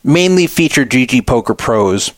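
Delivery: usual speaking voice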